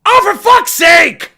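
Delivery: loud, high, drawn-out yell; exasperated shout. Acted. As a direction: explode at the endless delay. Game dialogue will not end.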